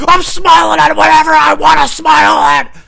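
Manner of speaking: high, loud, drawn-out scream of defiance; furious screaming. Retold or imitated. Employee storming toward the customer, furious screaming. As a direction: re-enact snapping, screaming at full force. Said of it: "I was screaming my ass off".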